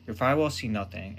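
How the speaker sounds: ordinary talk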